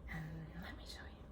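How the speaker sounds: hushed, quick whisper, leaning into a secret; secretive whisper. Acted. Knitter teasing viewers before the reveal, in a whisper. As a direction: breathe it like a little secret, fast.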